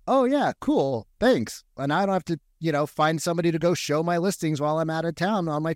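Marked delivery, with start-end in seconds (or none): 0.1-1.6 high, breezy, easygoing; casual thanks. Retold or imitated. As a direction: toss off a relaxed thank-you. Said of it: "He's like".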